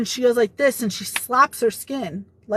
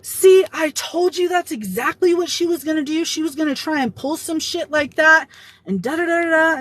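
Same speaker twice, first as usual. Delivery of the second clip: higher-pitched mock freak-out; retold furious outburst; 6 semitones higher than usual. Coworker freaks out at the HR meeting, mock furious. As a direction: imitate her indignant rant, pitched up. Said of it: "furious, like, freaking out".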